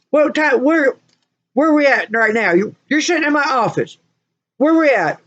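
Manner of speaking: high, pointed questioning; doctor scolding. Retold or imitated. Doctor to patient sitting in the office, scolding.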